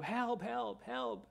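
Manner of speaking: high mock cry of help; imitated cries for help. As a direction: echo the neighbor's panicked calls. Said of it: "estaba gritando". (grito de auxilio imitado, agudo)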